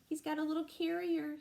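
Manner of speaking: ordinary delivery, nothing marked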